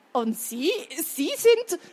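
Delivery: halting, rising to a high, loud finish; flustered stammer, climbing in pitch. A narrator's reading. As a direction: stumble over the words in shock. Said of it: "stotterte Harold".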